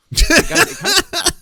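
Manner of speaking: breaking into high laughter; laughing through the words. Real, not acted. Laughing while reading.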